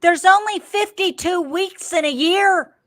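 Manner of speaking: loud, high-pitched disbelief; indignant outburst at a high pitch; incredulous protest. Real, not acted. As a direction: exclaim it high and loud, scandalized. Outraged at the episode count.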